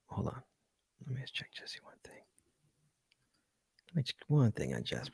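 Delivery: whispered aside off mic; whispering so the show start stays clean. Real, not acted. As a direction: hush it right down, a quick whisper. Pre-show aside before counting in.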